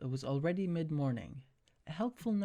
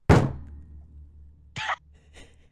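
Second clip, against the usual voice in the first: real burst of laughter at a stumble; laughing at a flub; 12 dB louder than usual. Real, not acted. Reader laughing after tripping over a word. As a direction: crack up at own mistake. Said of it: "ex-, ex".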